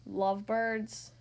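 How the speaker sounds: slow, low, puzzled echo. Acted. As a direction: drag it out, baffled. Elephant echoes in disbelief.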